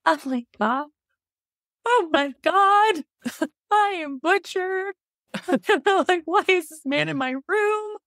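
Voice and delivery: high, panicky squeals broken by laughs; hammed-up high panic, laughing through it; freaking out. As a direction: shriek it like you just woke up terrified. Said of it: "freaking out".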